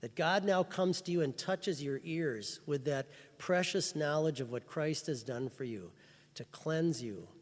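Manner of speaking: ordinary talk, nothing marked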